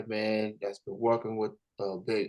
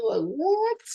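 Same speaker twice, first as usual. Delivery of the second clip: much higher, rising incredulous what; stunned what. Real, not acted. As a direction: react in total disbelief. Reacting to European remark.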